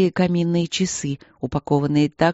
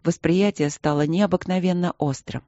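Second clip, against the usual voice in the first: plain reading voice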